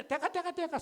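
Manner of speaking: urgent stop call. Retold or imitated. Leader halting the crowd about to kill Pablo, urgent and high. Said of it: "Sabi niya".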